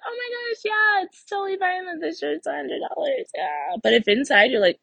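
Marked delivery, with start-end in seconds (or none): 0.1-3.8 much higher and softer, forced cheer; sugary put-on cheer while smiling and nodding; fake smiling agreement. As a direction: pretend you're fine with it, smiling. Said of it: "smile and nod and be like".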